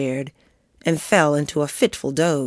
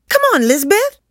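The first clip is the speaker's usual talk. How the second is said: put-on high, loud kid's call; urging call; 5 dB louder than usual. A narrator's reading. Kids urging Lizbeth to join the game, calling out. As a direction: call out to hurry a friend along.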